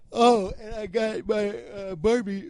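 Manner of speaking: words stretched by a yawn. Real, not acted. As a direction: talk through a yawn. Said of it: "I can't stop yawning".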